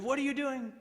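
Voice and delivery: high, loud challenge from a guard; alarmed officer. Retold or imitated. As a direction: demand it sharply, imitating a suspicious officer. Officer challenges prisoner caught reaching.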